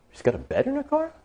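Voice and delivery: high, hushed disbelief; incredulous. Acted. Driver left alone at green light, incredulous. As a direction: puzzle over it in a squeaky, softened voice. Driver amazed by her answer.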